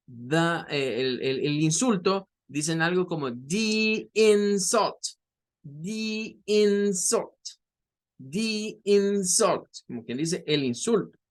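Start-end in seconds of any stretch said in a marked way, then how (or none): 3.3-5.0 slow demo of "the insult" with IN stressed; stress on first syllable. Shown as a demonstration. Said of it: "Marcando con más fuerza el in". 5.6-9.7 very slow, higher "the insult", stressing IN; drawn-out teacher demo, strong IN in "insult"; stress on first syllable. Shown as a demonstration. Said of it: "Marcando con más fuerza el in".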